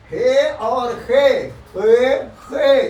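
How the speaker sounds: "हे" and "खे" said slowly from the throat; slow throaty pair. Shown as a demonstration. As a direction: contrast "hey" and "खे" slowly, both from the throat. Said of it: "दोनों हलक से निकलेंगे". ("हे" और "खे" धीरे, हलक से)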